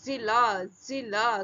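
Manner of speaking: जिला said with a buzzing z; teacher drilling जिला; nukta z sound. Shown as a demonstration. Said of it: "जा के नीचे नुक्ता".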